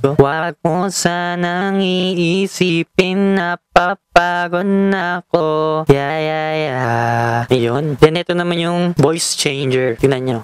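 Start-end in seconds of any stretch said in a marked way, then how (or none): 0.2-7.4 slow auto-tuned sung line; auto-tune. Shown as a demonstration. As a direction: let the auto-tune bend the voice. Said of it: "Parang ito yung auto-tune".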